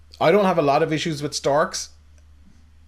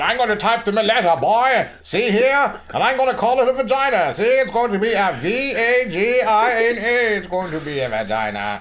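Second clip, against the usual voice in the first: high, loud old-movie tough-guy impression; hammed-up gangster voice, pitched way up; James Cagney; 10 semitones higher, 4 dB louder than usual. Irate listener writing in to the show, as a hammed-up Cagney. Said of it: "So James Cagney came back from the grave".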